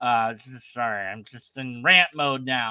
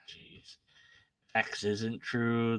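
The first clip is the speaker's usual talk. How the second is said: ordinary talk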